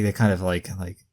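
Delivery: usual speaking voice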